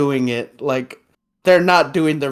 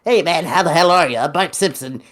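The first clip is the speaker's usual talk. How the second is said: attempted Bart voice; 3 semitones higher, faster than usual. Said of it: "He's like".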